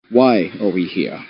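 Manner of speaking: ordinary talk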